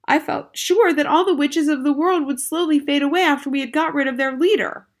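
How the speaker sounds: put-on high, loud upset boy; loud boyish complaint; cried out. A narrator's reading. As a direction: argue it with rising dismay. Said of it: "I cried".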